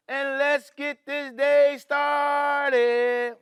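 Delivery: slow, drawn-out rallying call; rally the class to start the day; excited cheer. Acted. Opening cheer, slow and loud.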